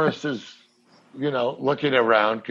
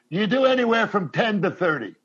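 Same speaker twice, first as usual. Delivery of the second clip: Hank; 7 semitones higher than usual. Retold or imitated. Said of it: "And Hank goes".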